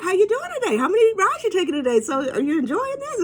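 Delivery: high, eager chatter; chatty rider. Retold or imitated. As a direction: pepper the driver with bubbly questions. Chatty rider voiced to the driver.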